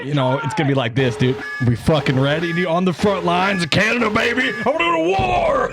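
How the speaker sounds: building from normal to a high, laughing battle cry; mock war rally cry. Hyping buddies for war with Canada, mock rallying cry. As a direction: rev up steadily, pitch climbing to a yell.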